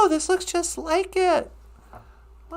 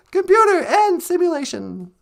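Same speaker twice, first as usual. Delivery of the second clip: normal talking voice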